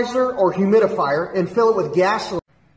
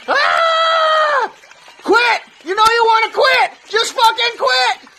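extremely high, full-force screaming; screamed taunting; 5 dB louder, slower than usual. Acted. Instructor demanding that Ernie quit, frenzied high scream.

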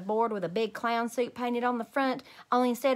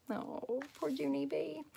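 soft, higher, tender pity; gentle softer aside of sympathy; sympathetic; 5 semitones higher, 9 dB quieter, slower than usual. Real, not acted. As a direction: coo it softly, feeling sorry for her. Reader pities the story's heroine.

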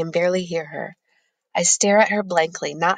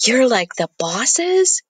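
higher, slow, a breathless gasp of amazement; gasping in surprise; 5 semitones higher, 4 dB louder, slower than usual. A narrator's reading. Boy gasps at the girl's wealthy origins, slow and amazed. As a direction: let the words fall out in shocked wonder. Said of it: "he gasps".

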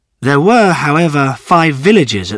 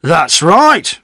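higher-pitched, lightly voiced old villager; old man agreeing; 6 semitones higher, 4 dB louder than usual. A narrator's reading. Old villager backing the butcher, agreeing. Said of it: "agreed old Mister Macy".

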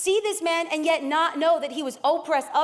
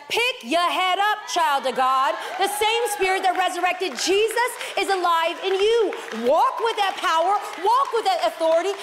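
loud, rising preacher's call; rally them up from the pulpit; full-force preaching; 3 semitones higher, 4 dB louder than usual. Acted.

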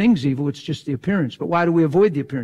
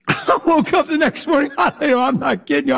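higher, louder, laughing still; 11 semitones higher, 4 dB louder than usual. Real, not acted. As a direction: tell it through laughter. Still laughing while telling.

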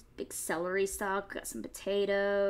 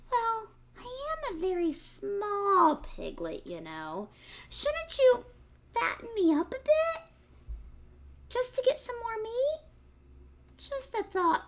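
much higher, sweet piglet voice; little piglet voice; 12 semitones higher than usual. Acted. Piglet sweetly suggests the fox fatten him up first. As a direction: coax the fox like a clever little pig. Piglet pleading in read story.

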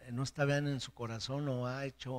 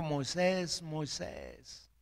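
God calling Moses; 4 semitones higher, much slower than usual. Acted. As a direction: call Moses by name as God. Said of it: "A Moisés le dijo".

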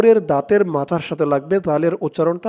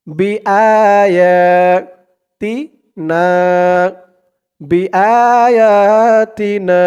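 slow, melodic tajweed recitation; slow Quranic chanting; 2 semitones higher, 6 dB louder, much slower than usual. Shown as a demonstration. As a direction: chant each word long and drawn out. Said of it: "এক আলিফ টান খাড়া জবর".

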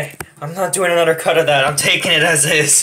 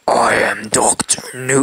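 slow, raspy growl; attempted superhero rasp; Batman. Introducing the villain by name, Batman voice. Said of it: "I can't do the Batman voice".

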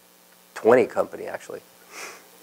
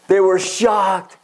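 higher and slow, stretched out; shocked emphasis; 7 semitones higher, much slower than usual. Real, not acted. Teller describing the crowd's amazement, slow and higher. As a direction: draw out the shock.

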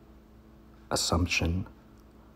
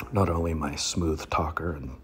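usual speaking voice

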